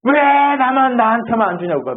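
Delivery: high-pitched acted grievance; mock complaining. Elder son griping to his father, mock complaining. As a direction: drag out the first word in a whiny mock protest. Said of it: "안 준다고 불평하고".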